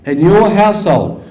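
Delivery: usual speaking voice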